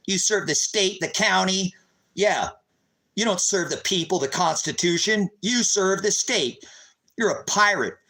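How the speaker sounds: ordinary talk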